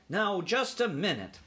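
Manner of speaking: doubtful uncle cutting in; objecting uncle. A narrator's reading. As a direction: break in to object.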